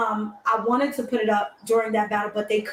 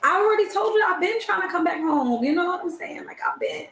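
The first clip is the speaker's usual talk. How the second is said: pleading; 8 semitones higher than usual. Real, not acted. Pleading to come back home.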